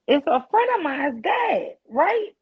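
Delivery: loud, slow, outraged emphasis. Real, not acted. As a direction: stress how shocking it was. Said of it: "outraged retelling".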